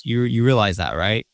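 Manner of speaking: ordinary talk, nothing marked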